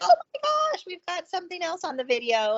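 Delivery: high, thrilled squeal of discovery; breathless high-pitched glee; excited gasp. Retold or imitated. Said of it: "she was just so excited".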